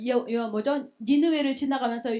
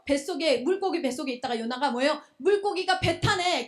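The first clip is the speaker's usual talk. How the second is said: ordinary talk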